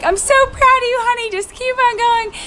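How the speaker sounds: high-pitched proud mom, a touch tearful; imitated tearful mom. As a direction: imitate an emotional mother urging her child on. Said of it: "My mom was crying".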